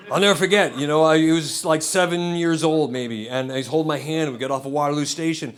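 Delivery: usual speaking voice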